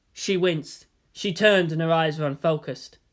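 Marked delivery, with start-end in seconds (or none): none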